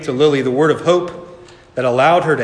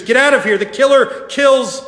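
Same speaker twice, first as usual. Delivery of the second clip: urgent warning cry; 11 semitones higher, 4 dB louder than usual. A narrator's reading. Leaves telling the sisters to get out, urgent cry. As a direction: cry out to make them flee.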